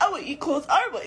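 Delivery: put-on laughing high child voice; child's voice. Mother imitating her child, laughing through it. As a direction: mimic a greedy little kid, giggling. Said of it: "You know how children just be like".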